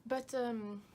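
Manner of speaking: British attempt slipping into French accent. Shown as a demonstration. Vlogger stuck in an accent slip, French accent. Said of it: "This developed into a French accent".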